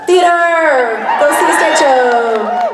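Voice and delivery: loud, laughing plug shouted to the crowd; playful shout with a laugh; calling out. Real, not acted. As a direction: pitch it up and sell the stage show. Plugging theater to the crowd.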